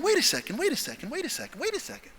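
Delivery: high, hushed, repeated catch; dawning realization. Retold or imitated. Trainee putting the pieces together, high hushed realization.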